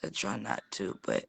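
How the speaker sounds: very quiet, low, trying not to cry; holding back tears. Real, not acted. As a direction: let the voice sink as tears threaten. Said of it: "it makes me wanna cry".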